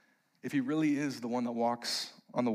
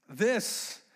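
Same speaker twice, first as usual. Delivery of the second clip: ordinary talk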